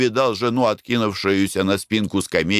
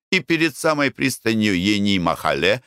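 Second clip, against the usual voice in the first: ordinary delivery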